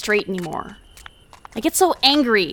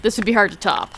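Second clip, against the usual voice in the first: usual speaking voice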